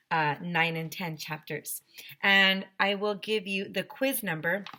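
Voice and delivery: usual speaking voice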